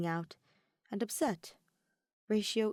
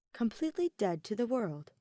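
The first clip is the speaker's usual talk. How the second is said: usual speaking voice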